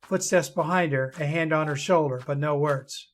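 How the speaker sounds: soft, low storytelling voice; story narration. A narrator's reading. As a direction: read it gently, like a fireside tale.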